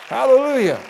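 high, loud Hallelujah; shouting praise to the Lord; joyful praise shout. Real, not acted. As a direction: lift the roof with praise. Calls for praise.

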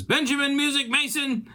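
you're in trouble, mom's calling; mom calling the full name. Retold or imitated. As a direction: mom summoning her son who's in trouble.

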